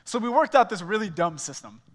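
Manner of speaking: ordinary delivery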